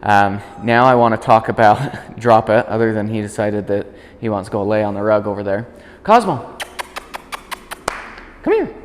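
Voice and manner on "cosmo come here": high, sing-song call to the dog. Real, not acted. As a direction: call the dog across the room. Calling the dog over.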